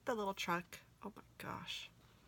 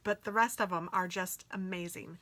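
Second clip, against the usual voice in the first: usual speaking voice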